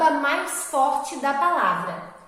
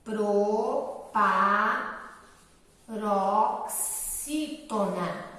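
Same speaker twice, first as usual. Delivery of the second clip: "Proparoxítona" in very long separate syllables, lower; slow dictation; 4 semitones lower, 4 dB quieter, much slower than usual. Shown as a demonstration. Teacher writing the term for pupils, very slow low dictation. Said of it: "[name] vai escrever aqui".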